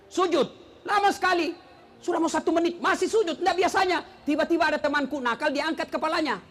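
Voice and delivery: normal talking voice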